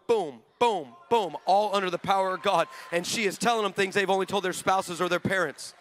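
ordinary talk, nothing marked

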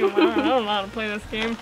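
ordinary talk, nothing marked

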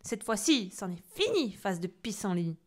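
loud furious threat; furious witch. Acted. Witch about to strike the boy, furious. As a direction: spit the final threat.

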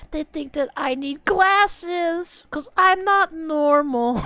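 high, slow whiny mock of the song; mocking whine. Streamer mocks the song's lyric in a high whine.

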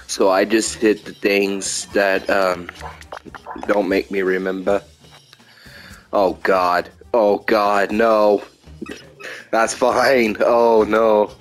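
ordinary delivery

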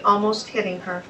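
ordinary delivery, nothing marked

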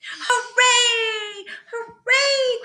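high, joyful cheer; high mock cheer; cheering clown. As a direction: celebrate the top of the mountain. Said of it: "cried the little clown".

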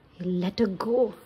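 usual speaking voice

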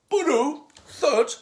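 high, loud imitated bolt whoosh and thud; mock sound effect of a crossbow shot; crossbow noise. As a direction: make the sound of the bolt flying and landing.

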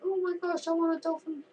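very high-pitched, hammed-up longing; mock excited squeal. Gamer retelling his reaction to a dolphin, mock excitement. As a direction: squeak it out like an overexcited fan. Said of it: "and I was like".

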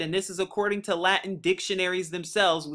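ordinary talk

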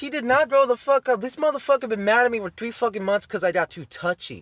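high, loud indignant rant; irate outburst. Real, not acted. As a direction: fire back at full volume, outraged. Irate reaction to played clip.

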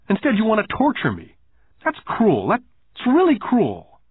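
put-on wounded boy's high-pitched accusation; acted hurt indignation; aggrieved protest. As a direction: accuse him, pitch climbing, as the upset child. Boy airs grievance in acted quarrel.

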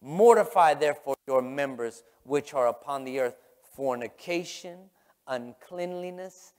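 emphatic pulpit Bible reading; forceful scripture preaching. Acted. Preaches the verse at full force.

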